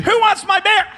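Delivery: very high, loud crowd-rousing yell; excited shout. Real, not acted. Preacher asking a child who wants the bear, excited shout. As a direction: yell it out to fire up the room. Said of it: "So when I say".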